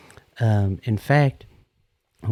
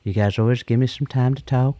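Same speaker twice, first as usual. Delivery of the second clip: ordinary talk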